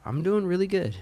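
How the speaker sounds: ordinary delivery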